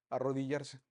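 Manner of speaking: ordinary delivery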